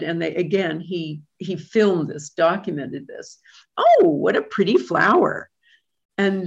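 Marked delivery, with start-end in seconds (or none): 3.8-5.5 parrot voice. Retold or imitated. Parrot voice in a story.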